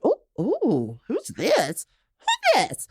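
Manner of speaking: playful, rising excitement that peaks high; delighted preening. Retold or imitated. Admiring herself in the new pink fascinator, rising delighted squeal. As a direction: squeal it as you admire yourself.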